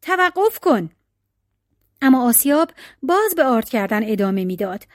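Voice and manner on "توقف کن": high, urgent storybook command; restrained yell in a raised voice; narrated cry. A narrator's reading. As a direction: cry out a halt, pitched up without a full shout. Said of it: "مرد فریاد زد".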